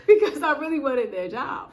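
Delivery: laughing through the words. Real, not acted. Retelling the job offer to viewers, laughing. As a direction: laugh while you say it.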